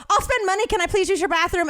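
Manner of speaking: high pleading; desperate begging. Retold or imitated. Begging the liquor store clerk, high and pleading. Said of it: "I go".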